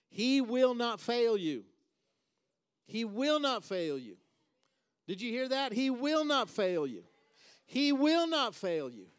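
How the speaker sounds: slow, high, loud preacher's proclamation; full-force preaching. Real, not acted. Preacher assuring the congregation God will not fail, full-force preaching. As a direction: drive each word home, loud and drawn out.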